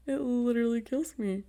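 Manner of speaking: normal talking voice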